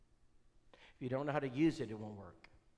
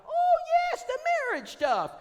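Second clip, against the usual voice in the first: very high, loud falsetto woman; high falsetto imitation of Brenda; Brenda's voice; 12 dB louder than usual. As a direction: squeal it like Brenda at the door. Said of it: "she said".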